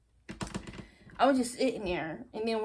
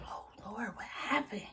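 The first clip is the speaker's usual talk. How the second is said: hushed, voiceless gossip whisper; imitating a secretive whisper; acted whisper; 11 dB quieter than usual. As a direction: whisper it like a scandalized friend. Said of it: "So I was whispering to him".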